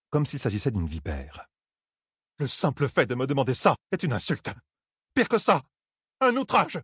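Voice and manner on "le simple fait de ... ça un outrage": indignant protest climbing to a high-pitched cry; outraged. A narrator's reading. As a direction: build from offence to shrieked outrage. Talène outrée refuse le serment. (indignation montante, de plus en plus aiguë)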